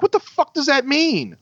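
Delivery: high-pitched incredulous demand; retold flare-up of disbelief; exasperated outburst. Driver challenging the truck owner's excuse, exasperated.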